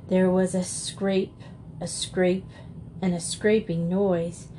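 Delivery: ordinary delivery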